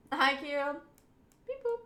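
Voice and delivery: ordinary talk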